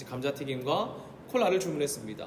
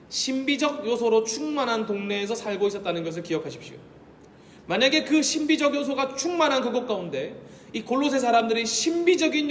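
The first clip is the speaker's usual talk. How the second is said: higher, louder sermon climax; full-force preaching; 4 semitones higher, 4 dB louder than usual. Acted. Pastor pressing the congregation, higher and louder. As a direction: drive the point home from the pulpit.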